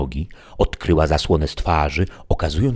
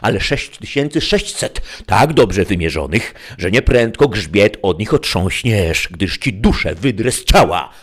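outburst high and loud, then settling; angry threat; 6 semitones higher, 4 dB louder than usual. A narrator's reading. Don Quixote threatening stubborn Sancho, angry outburst that settles. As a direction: burst out at the squire, then ease into menace.

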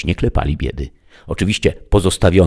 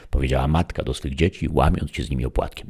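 usual speaking voice

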